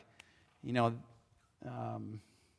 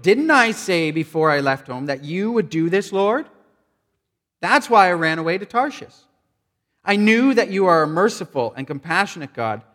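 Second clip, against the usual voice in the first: louder, higher, exasperated pleading; angry complaint; 4 semitones higher, 6 dB louder than usual. Acted. Jonah complaining angrily to the Lord, loud and exasperated. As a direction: complain bitterly to God, raising the voice. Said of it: "he complained to the Lord about it".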